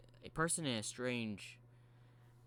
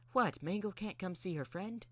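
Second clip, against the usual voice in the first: high-pitched, opening on a loud "what"; playful character voice pitched well up; high Mangle voice; 8 semitones higher, 3 dB louder than usual. Acted. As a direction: put on a high voice for a playful robot. Mangle voiced teasing Wave.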